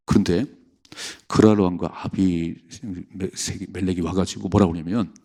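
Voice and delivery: ordinary talk, nothing marked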